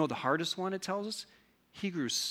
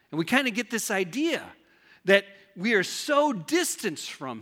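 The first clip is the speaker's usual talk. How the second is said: higher, louder, fired-up pulpit delivery; full-force preaching; 7 semitones higher, 7 dB louder than usual. Acted. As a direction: preach it out to the back row.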